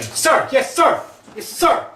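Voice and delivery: high, loud barked military reply; imitated shouting. Medium relays the man's shouts to the group, shouted high and loud. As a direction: shout it out like a soldier answering orders. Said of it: "keeps shouting".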